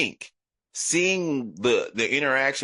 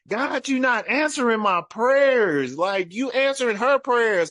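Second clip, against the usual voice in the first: high, impassioned protest to God; retold accusing plea; aggrieved prayer; 11 semitones higher, 3 dB louder than usual. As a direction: argue with heaven, pitched way up.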